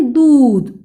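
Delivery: दूध with ऊ held long; long vowel demo. Shown as a demonstration. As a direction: stretch the ऊ in दूध. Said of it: "उच्चारण दीर्घ है".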